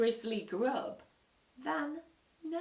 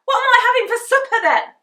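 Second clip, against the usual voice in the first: fast loud whine; 21 dB louder, faster than usual. Acted. Henry ranting.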